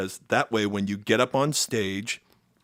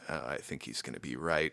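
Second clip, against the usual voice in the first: doubtful mutter; 7 semitones lower, 8 dB quieter, faster than usual. Retold or imitated. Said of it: "kind of going like".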